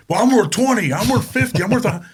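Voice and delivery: outbid each other in mock excitement; escalating brag. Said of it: "And they would go".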